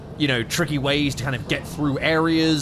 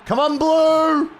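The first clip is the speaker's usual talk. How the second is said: slow, high urgent yell; cheering shout; 13 semitones higher, 5 dB louder, much slower than usual. Real, not acted. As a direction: scream encouragement at the fighter. Cheering fighter, getting tense.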